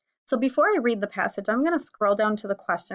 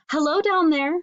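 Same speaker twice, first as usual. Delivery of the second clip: high, louder bird voice calling down; chirped bird greeting; 7 semitones higher, 4 dB louder than usual. Acted. Bird calling down to the rhinoceros, high chirpy greeting. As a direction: chirp it up brightly like a little bird. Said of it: "chirped the bird".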